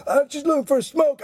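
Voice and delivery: high-pitched mock whine of excuse; hammed-up high junkie protest; thief calling back. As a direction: imitate a fleeing thief shouting back. Thief calls back while fleeing.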